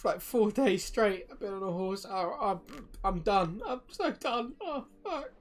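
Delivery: high, loud, breaking into sobs; sobbing distress. Acted. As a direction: cry it out in panic and grief. Farmer breaks down over friends.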